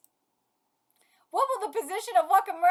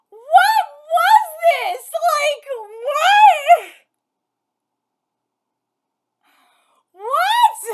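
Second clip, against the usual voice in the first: higher, loud, slow drawn-out what; baffled outcry; 8 semitones higher, 10 dB louder, much slower than usual. Real, not acted. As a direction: stretch each what in baffled shock. Baffled by the match.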